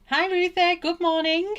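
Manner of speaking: high, loud singsong hello; excited greeting. Real, not acted. Painter greeting a viewer who joins, high loud cheery call. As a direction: call out a bright, high welcome.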